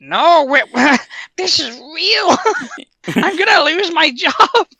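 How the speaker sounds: high, slow, drawn-out protest; hammed-up high-pitched alarm; mock panic. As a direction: plead in a panicked comic voice. Alan protests that it is real.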